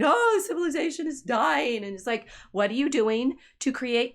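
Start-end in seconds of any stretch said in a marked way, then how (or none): 0.0-1.8 a lightly higher mocking whine of doom; mock doom-sayer. Mocking people who complain about culture, a lightly higher whiny voice. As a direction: parody the gloomy complainers. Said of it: "hearing people saying".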